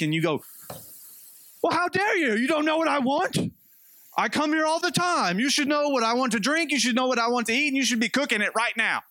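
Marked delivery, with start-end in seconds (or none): 1.6-9.0 high, indignant entitled customer; hammed-up entitled diner, high and pushy; mock Karen rant. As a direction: play the outraged Karen demanding service. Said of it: "you would be being a Karen".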